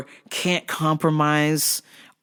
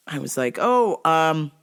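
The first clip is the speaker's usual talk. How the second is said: usual speaking voice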